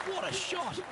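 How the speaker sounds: sudden burst of high excitement; excited exclamation. Real, not acted. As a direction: cry out in delight at the shot. Commentator reacts to great shot.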